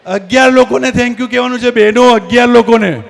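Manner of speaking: loud, insistent crowd instruction; calling out reminder. Real, not acted. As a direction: push it over the chatter. Calling over the mingling crowd.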